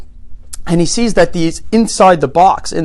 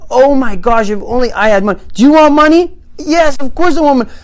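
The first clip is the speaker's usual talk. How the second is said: thrilled alien; 9 semitones higher, 5 dB louder than usual. Acted. Alien thrilled by offer of money.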